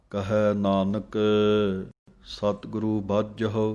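slow, devout chanting of scripture; measured devotional reading; slow gurbani recitation. Acted. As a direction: recite it slowly like a hymn.

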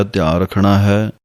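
usual speaking voice

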